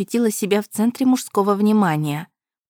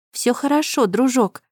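gentle, higher reassuring voice; soothing; 5 semitones higher than usual. Acted. Heroine soothing the pushing dog. (ласково, успокаивающе)

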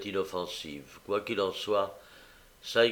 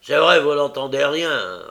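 loud scornful threat; threatening mocking retort; menacing sneer; 4 semitones higher, 10 dB louder than usual. A narrator's reading. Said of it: "avec un rire menaçant".

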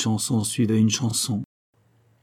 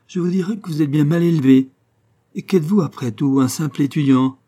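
higher, louder indignant scolding; scornful retort; 5 semitones higher, 5 dB louder than usual. A narrator's reading. The professor's daughter scolds the student, loud and scornful. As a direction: put him in his place.

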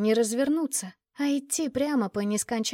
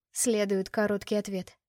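plain reading voice

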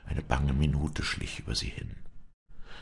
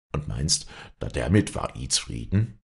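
ordinary delivery

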